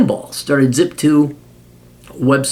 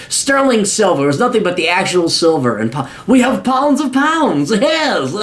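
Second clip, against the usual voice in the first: high, haughty British boast about family silver; snooty British rich kid; 6 semitones higher than usual. Pretend. Spoiled son boasting of family wealth, snooty British accent. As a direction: brag like a pampered colonial heir. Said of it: "It still sounds snooty and British".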